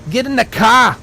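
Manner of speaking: mock a Boston tough guy ordering someone in; hammed-up Boston accent. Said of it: "Boston accent".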